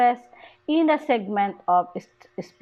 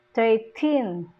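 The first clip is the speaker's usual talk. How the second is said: put-on "thirteen" with a drawn-out "teen"; stress on last syllable. Shown as a demonstration. Teacher shows number stress, last syllable of "thirteen" stressed. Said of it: "the stress falls on the last syllable".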